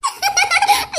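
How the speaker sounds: high, soft laughter; giggling. Acted. Laughing after the call.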